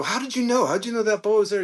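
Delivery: asking his mentor how he knew, amazed; incredulous question. Retold or imitated. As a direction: sound floored that he knew.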